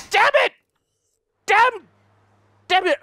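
high, loud angry curses; frustrated yell. Real, not acted. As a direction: bark out the frustration. Frustration at a game mistake.

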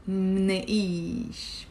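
"منقيش" said slowly, mn cluster, long ee; Lebanese plural. Shown as a demonstration. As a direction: draw out "منقيش" as one syllable. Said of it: "I would write it M N E".

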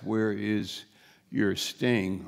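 ordinary talk, nothing marked

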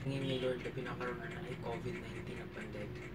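soft, quiet prayer. Acted. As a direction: pray softly to God. Prayer to God.

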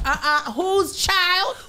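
high, very drawn-out disapproval; quick challenge. Acted. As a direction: call out the stray kid, pitched high and slow. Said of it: "I'm quick to be like".

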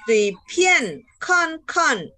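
PIAN, KON each high then dropping; sharp fourth tone. Shown as a demonstration. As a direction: punch each of "tui, pian, kon, kon" downward. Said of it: "ini nada empat".

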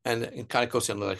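usual speaking voice

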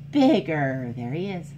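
lively storybook voice for little ones; animated read-aloud for kids; reading to children. A narrator's reading. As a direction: read it like a picture book at story time. Reads picture book aloud.

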